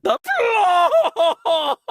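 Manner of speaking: high shout dissolving into laughter; startled laughing shout. Acted. Host startled in the dark, high laughing shout. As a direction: blurt it, then laugh it off.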